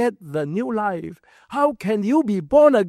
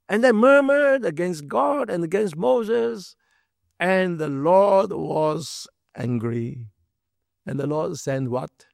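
ordinary talk, nothing marked

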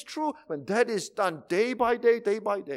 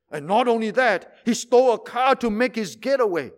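louder, higher eager telling; eager gossip; 4 semitones higher, 7 dB louder than usual. Acted. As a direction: relish the next scandal. Gossip adds more news.

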